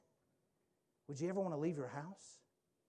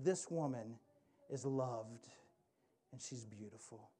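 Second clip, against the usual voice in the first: tender, fading softly; 6 semitones lower, 5 dB quieter than usual. Retold or imitated. Jesus telling the crowd the woman is loved, tender and soft. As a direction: lower and softer, tender reassurance. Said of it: "brings you to himself and says".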